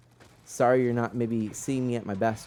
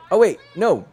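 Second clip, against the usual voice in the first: surprised; 10 semitones higher, 11 dB louder than usual. Real, not acted. Sudden in-game mishap.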